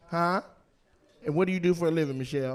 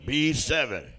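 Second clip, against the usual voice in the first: bingo caller; 3 semitones lower, slower than usual. Retold or imitated. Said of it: "call out a bingo".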